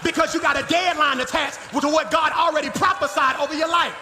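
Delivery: high, driving sermon shout; impassioned preacher building in punched phrases; full-force preaching. Real, not acted. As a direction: drive each phrase home to the congregation.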